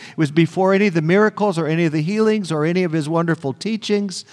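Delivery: ordinary talk, nothing marked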